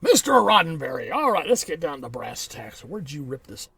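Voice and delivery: lightly hammed-up courtroom interrogator; playing at a prosecuting attorney; mock prosecutor. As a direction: open loud, then settle into questioning the accused. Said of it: "prosecuting attorneys in the entertainment".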